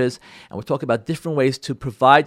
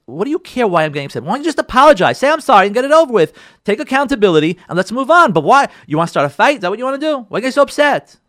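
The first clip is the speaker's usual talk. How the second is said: higher, louder combative comeback; exasperated retort; 6 semitones higher, 4 dB louder, faster than usual. Acted. Hurt spouse answering back, exasperated. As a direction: fire back, demanding an apology. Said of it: "what you wanna say to your spouse".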